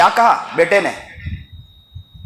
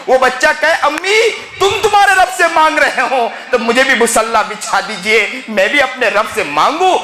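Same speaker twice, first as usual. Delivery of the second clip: raised, emotive sermon storytelling; impassioned preaching; 4 semitones higher than usual. Real, not acted. Preacher tells congregation of a weeping mother, impassioned preaching. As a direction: preach the parable with swelling fervour.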